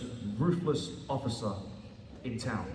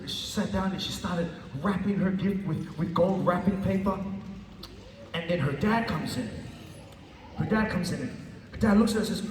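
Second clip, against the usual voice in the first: usual speaking voice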